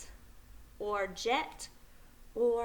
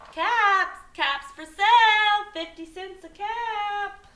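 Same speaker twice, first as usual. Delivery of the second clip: street vendor's call; 9 semitones higher, 4 dB louder than usual. Acted. Peddler walking back into town, calling out his wares. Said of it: "he walked back into town calling".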